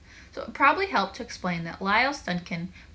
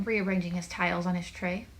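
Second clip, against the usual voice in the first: usual speaking voice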